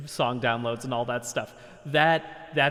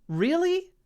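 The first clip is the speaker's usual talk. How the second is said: incredulous; 14 semitones higher than usual. Real, not acted. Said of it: "mocking disbelief at the scammer".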